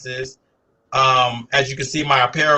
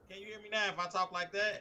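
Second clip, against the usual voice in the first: raised voice; 6 semitones higher, 16 dB quieter than usual. Real, not acted. Guest raising his voice on a faint mic to the host, speaking up. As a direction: speak up, pitched higher, to carry over a weak mic. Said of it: "There you go. Can hear you better".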